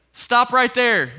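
imitating a security guard calling out; shouted order. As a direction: halt someone with a sharp shout.

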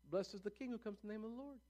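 softly imitated cheering; hushed acclaim. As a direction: praise in a small, light voice. Recalls crowd's earlier acclaim.

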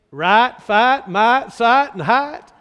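punchy demonstration of a Southern drawl. Shown as a demonstration. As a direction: lean on each word in a Southern accent. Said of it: "a good southern drawl".

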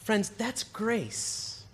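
a quiet, slow, tender declaration; soft, slow grace. Real, not acted. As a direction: lay it down gently for the congregation. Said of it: "preacher's tender key point".

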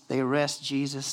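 ordinary talk, nothing marked

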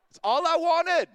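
normal talking voice

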